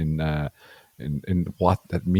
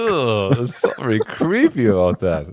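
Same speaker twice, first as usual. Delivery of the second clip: disgusted; 6 semitones higher, slower than usual. Real, not acted. Disgusted at creepy ad.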